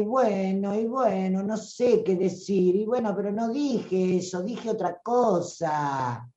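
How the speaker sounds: a hammed-up plaintive little-girl voice; childish whiny voice. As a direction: whine like a child asking to be looked after. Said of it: "la que habla aniñadamente o lamentosamente".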